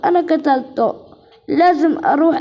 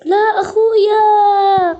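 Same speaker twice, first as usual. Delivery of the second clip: slow, higher, louder sobbing cry; crying for brother; 6 semitones higher, 7 dB louder, much slower than usual. Acted. Girl finding her brother dead, loud slow sobbing cry. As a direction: wail his name as you break down.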